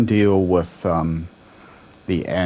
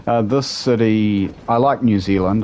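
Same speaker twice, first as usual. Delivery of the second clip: normal talking voice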